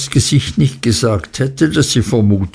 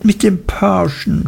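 higher, mildly tearful retort; a touch of a sob in it; understated sobbing reply; 8 semitones higher than usual. Acted. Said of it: "schluchzte Dora".

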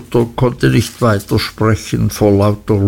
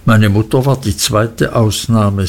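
ordinary delivery

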